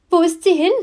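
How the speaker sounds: high, loud, frantic question; desperate question. Acted. Eddie pleads with her dad on the phone, frantic. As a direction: push for an answer in rising panic.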